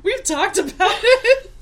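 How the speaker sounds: high-pitched, worked-up protest; mock exasperation. Real, not acted. Host defending her dislike of an episode to co-host, exasperated. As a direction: squawk back in playful outrage.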